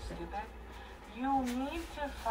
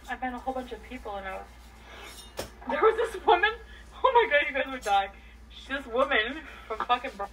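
ordinary delivery

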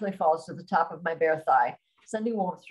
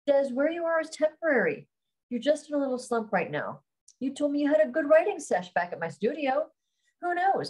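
higher-pitched consoling friend; encouraging best friend; 6 semitones higher than usual. Acted. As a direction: gently lift a friend out of a slump. Voices Candy consoling her friend.

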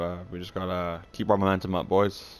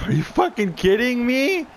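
very high, slow outraged disbelief; can't believe they scored; high, drawn-out exasperation; 3 dB louder than usual. Real, not acted. Opponents just scored.

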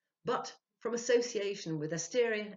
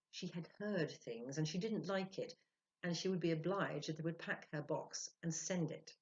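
ordinary delivery

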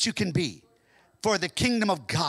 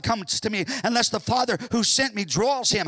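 loud, high, ringing sermon voice; fervent revival preaching; full-force preaching; 6 semitones higher, 6 dB louder than usual. Acted. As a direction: preach it out to the whole church. Preaching scripture, building.

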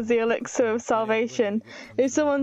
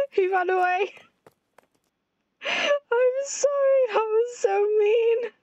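very high, slow, tearful whine; guilty wail; 10 semitones higher, slower than usual. Real, not acted. Player sorry the character ran away, guilty wail. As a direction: draw it out as if crying.

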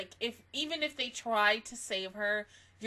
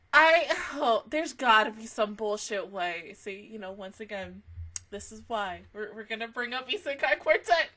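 usual speaking voice

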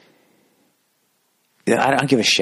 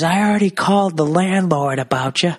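put-on high, tattling old-guy voice; hammed-up cranky tenant; imitating indignant neighbor; 9 semitones higher than usual. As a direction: throw it out as a high, mock threat. Acted neighbor in a quarrel.

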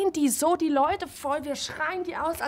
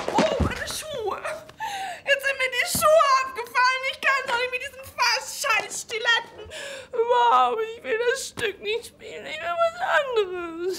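mock whining; 8 semitones higher than usual. Whining about shoes, refusing play.

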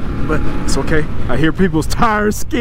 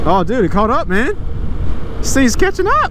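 excited; 7 semitones higher than usual. Real, not acted. Rocket Man closing in.

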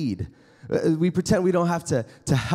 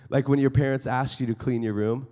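usual speaking voice

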